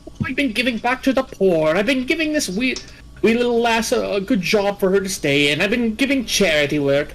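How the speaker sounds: high hammed-up Irish brogue; mock Irish accent. Imitating the Irish defendant's stage Irish accent. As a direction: play a cheery stage Irishman. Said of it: "facade of this Irishman".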